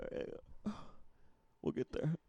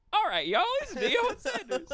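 mocking Deion act; 13 semitones higher, 10 dB louder than usual. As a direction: imitate your friend's goofy Deion voice. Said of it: "it's just you being like".

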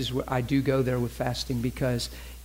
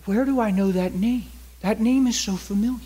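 higher, louder wondering aloud; puzzled recognition; 7 semitones higher, 4 dB louder than usual. Acted. Man hearing a familiar name in his office, puzzled recognition. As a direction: puzzle it out in a raised voice.

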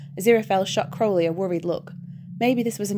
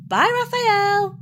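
much higher, slow, sing-song mock farewell; cheeky bye; 13 semitones higher, 5 dB louder, slower than usual. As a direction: wave it off playfully, drawn out. Crowley cheekily waves at portal.

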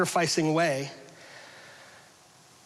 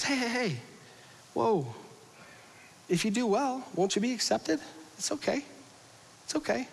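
soft, high soothing voice; calm him down like a frightened child; God gently calming Cain; 5 semitones higher, 5 dB quieter than usual. Acted. God soothing jealous Cain, soft and reassuring. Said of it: "You can almost hear the Lord saying".

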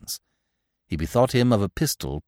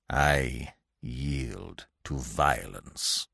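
very slow, low, hushed menace; snarling surrender; 6 semitones lower, 6 dB quieter, much slower than usual. A narrator's reading. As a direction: yield through bared teeth, slowly. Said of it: "like a snarling dog".

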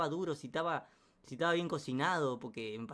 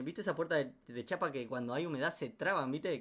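ordinary talk, nothing marked

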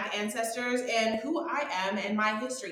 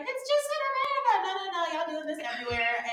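high-pitched, fast, incredulous no-no-no; exasperated rebuttal; 9 semitones higher, much faster than usual. Retold or imitated. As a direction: snap back in disbelief. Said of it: "people are always like".

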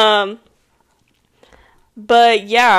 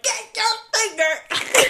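mock angry yell; 12 semitones higher, slower than usual. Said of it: "I'm like".

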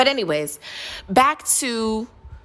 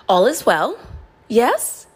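ordinary talk, nothing marked

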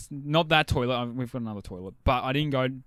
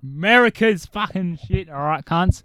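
loud; 5 semitones higher, 9 dB louder than usual. Acted. Mock patriotic rant.

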